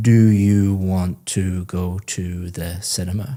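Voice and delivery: each word said separately and carefully; unconnected speech, a small gap after every word; learner's word-by-word speech. Shown as a demonstration. Teacher imitating an English learner asking about movies, word by word. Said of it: "someone learning English might say".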